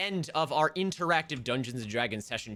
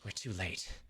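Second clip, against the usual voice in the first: muttered curse; 2 semitones lower, 9 dB quieter, much slower than usual. Acted. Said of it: "and she goes".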